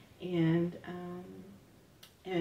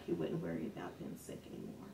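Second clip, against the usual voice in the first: whispered, low and hushed; whispered threat; 13 semitones lower, 13 dB quieter, faster than usual. Retold or imitated. Teacher whispering in her ear, a hushed whisper. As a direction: murmur it close to her ear. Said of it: "whispering it".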